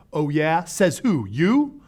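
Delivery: rising, challenging doubter's retort; skeptical. Acted. Doubting consumer answers a brand claiming it's better, skeptical. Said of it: "It also raises skepticism".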